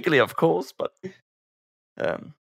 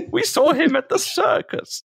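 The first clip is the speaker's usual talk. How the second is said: put-on much higher, prim old toff voice; mock old posh Brit; 14 semitones higher than usual. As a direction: reminisce like an ancient aristocrat. Playing the ancient toffs.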